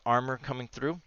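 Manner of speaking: ordinary delivery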